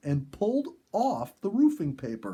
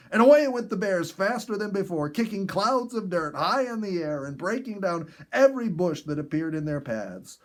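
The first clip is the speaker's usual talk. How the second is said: louder and higher, racing through the chase; excited narration; 6 semitones higher, 5 dB louder than usual. A narrator's reading. Narrator telling of the bears fleeing, excited and loud. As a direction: tell it like the bears are bolting past you.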